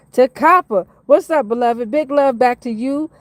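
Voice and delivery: high, loud, delighted shout-out; excited greeting. Real, not acted. Greeting Takapa and the other regulars, excited. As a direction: greet a favourite follower with excitement. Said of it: "I get excited".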